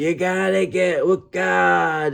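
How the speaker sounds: high, slow, preachy mock voice; sneering churchgoer scolding her about God; mock preachy believer. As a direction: parody a pushy churchgoer, drawn out. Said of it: "they'll say".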